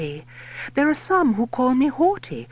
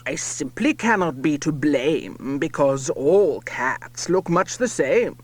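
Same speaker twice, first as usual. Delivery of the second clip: put-on much lower, pompous old lord; low lordship voice; 8 semitones lower than usual. A narrator's reading. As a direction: insist like a boozy old lord. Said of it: "His lordship cries".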